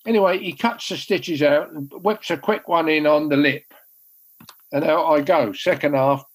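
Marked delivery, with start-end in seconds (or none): none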